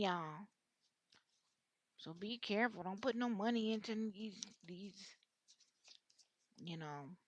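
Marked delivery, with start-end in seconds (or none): none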